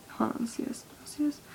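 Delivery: normal talking voice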